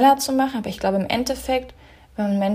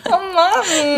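mock exasperated; 10 semitones higher, 7 dB louder than usual. Real, not acted. Teasing reaction.

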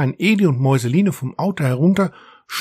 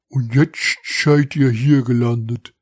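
Diederich noting the obvious; 2 semitones lower than usual. A narrator's reading. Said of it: "stellte Diederich fest".

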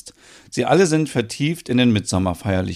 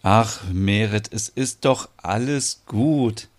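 slow, soothing reassurance; tender comforting; slower than usual. Acted. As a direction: comfort someone crying for joy, unhurried. Consoles weeping Merit.

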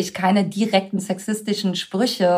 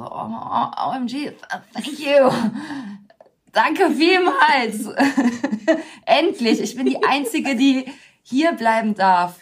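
high-pitched, hammed-up thankfulness with laughs; mock gushing gratitude; 5 semitones higher than usual. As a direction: gush as if absurdly honoured. Acting out overjoyed thanks, ironically.

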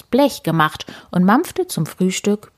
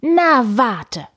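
higher-pitched, vexed warning; indignant threat; 6 semitones higher, 3 dB louder than usual. A narrator's reading. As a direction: warn them they'll pay for it. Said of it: "erboste sich Bö". (empört drohend)

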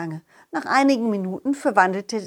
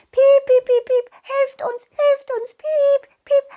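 very high, loud squeaky pleading; mice crying for help; 5 dB louder than usual. Acted. Mice squeak for help from the stream, very high and loud.

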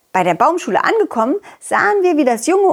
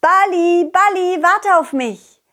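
calling out; 8 semitones higher than usual. Acted. Calling after rolling ball.

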